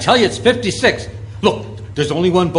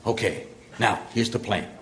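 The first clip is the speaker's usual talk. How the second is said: soft, low conspiratorial voice; hushed plotting; 7 semitones lower, 6 dB quieter than usual. Acted. Father hiding in the cabin explains his plan, hushed. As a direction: lay out the plan under your breath.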